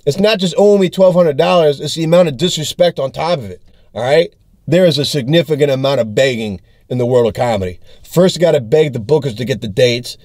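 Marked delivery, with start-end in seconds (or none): none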